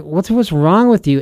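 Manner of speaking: high-pitched, exasperated challenge; acted freak-out. Preacher imagines the apostle confronting today's believers, acted freak-out. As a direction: play the apostle losing patience with them. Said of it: "I can imagine him freaking out".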